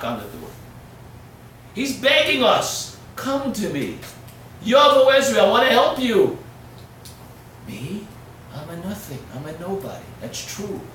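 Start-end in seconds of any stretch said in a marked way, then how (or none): 1.7-6.4 loud high shout, slowing at the end; preaching shout. Acted. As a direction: call it out to the whole room. Preaching climax.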